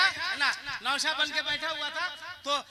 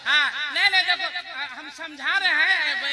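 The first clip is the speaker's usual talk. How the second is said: normal talking voice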